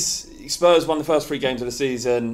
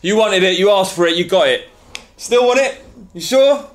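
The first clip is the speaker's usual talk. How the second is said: loud, high trailer announcer; 7 semitones higher, 6 dB louder than usual. Acted. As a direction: boom it like a promo. Segment jingle intro.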